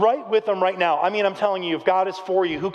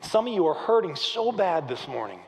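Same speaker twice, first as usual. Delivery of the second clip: normal talking voice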